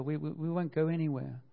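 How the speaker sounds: ordinary talk, nothing marked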